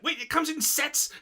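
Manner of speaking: high, astonished question; disbelieving delight at sets; amazed. Retold or imitated. Friend recapping anime, voicing astonished customer, high and amazed.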